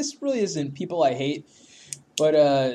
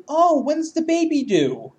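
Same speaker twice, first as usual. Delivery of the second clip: mock sweet question; 11 semitones higher, slower than usual. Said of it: "a chubby girl and say".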